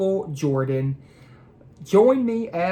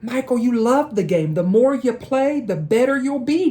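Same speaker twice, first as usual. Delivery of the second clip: put-on higher, upbeat kid voice; encouraging friend; 6 semitones higher than usual. David encouraging Michael about basketball, upbeat and higher. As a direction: cheer him on like a buddy. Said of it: "David said".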